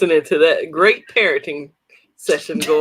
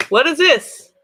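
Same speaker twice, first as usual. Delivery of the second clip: surprised; 10 semitones higher, slower than usual. Real, not acted. Surprised at the new purchase.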